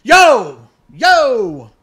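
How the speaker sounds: loud, high calling out; imitating a yell. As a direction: call across the aisle. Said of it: "She starts yelling".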